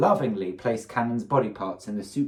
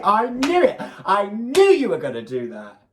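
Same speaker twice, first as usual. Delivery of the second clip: high, loud gleeful shout; laughing triumph, pitched way up; excited outburst; 10 semitones higher, 5 dB louder than usual. Real, not acted. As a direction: crow that you called it. Listener reacts to the story twist.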